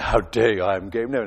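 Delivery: low, affronted "How dare you?"; indignant angel. Retold or imitated. Preacher imagining Gabriel rebuking Zechariah, low and indignant. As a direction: drop the voice low and stand on your dignity. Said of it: "imagine him standing and say".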